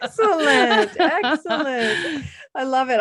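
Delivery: laughing while saying it. Real, not acted. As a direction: chuckle through the word. Laughing reply to guest.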